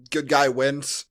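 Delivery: normal talking voice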